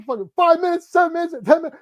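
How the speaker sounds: high, loud exasperated rant; mock rant. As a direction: spit out the times. Ranting director.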